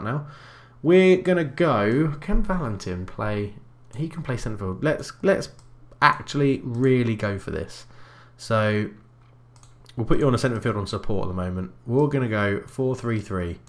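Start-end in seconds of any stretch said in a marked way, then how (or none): none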